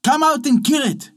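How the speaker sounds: high excited shout. A narrator's reading. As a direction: yell for him to come kill it. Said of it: "she cried".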